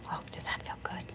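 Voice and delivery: soft whispered question to the dog. Real, not acted. Groomer whispering to the dog as she cleans his ears, whisper. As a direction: whisper it close and soothing.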